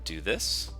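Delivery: normal talking voice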